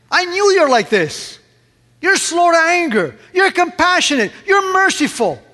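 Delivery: high, loud, mocking recital of God's virtues; sarcastic. Jonah throwing God's own words back at him, sarcastic and high. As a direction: throw the praise back with a sneer, pitched high. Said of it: "maybe even sarcastically".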